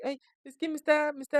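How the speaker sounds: high pleading frightened voice; scared caller. Retold or imitated. Caller being attacked on air, high panicked plea. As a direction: cry out that she is hurting you. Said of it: "empieza como a ponerse un poco así".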